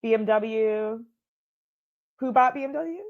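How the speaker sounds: disbelieving; surprised. Real, not acted. Surprised question about BMW.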